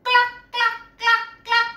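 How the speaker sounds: high, sharp hen clucks; high-pitched chicken call; clucking chicken. Acted. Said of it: "the chicken goes to Taylor and says".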